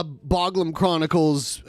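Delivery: ordinary talk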